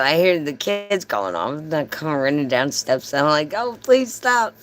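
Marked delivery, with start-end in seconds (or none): 3.6-4.5 high, pleading mock wail; retold crying plea. Customer running downstairs to stop the cutoff, mock crying. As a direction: beg with a put-on tearful whine. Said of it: "I'm crying".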